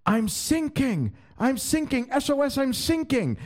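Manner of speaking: high, drawn-out cry of I'm sinking; panicked British sailor. Retold or imitated. British sailor calling for help, high panicked cry. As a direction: wail it like a sinking ship's radio call. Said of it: "you hear this British ship going".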